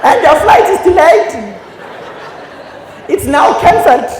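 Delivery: loud, high, laughing PA; mock announcement. Comic mocking an airport announcer, loud and laughing. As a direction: shout the bad news like a gleeful tannoy.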